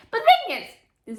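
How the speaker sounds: sudden high loud yelp; outburst. Real, not acted. Reader ranting about the lovers, sudden loud high yelp. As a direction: burst out with it. Passionate book rant.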